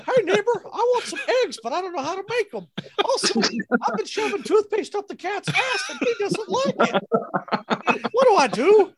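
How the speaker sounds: high, hurried helpless man's voice; clueless neighbor. Acted. Husband begging neighbor for help with eggs, high mock helplessness.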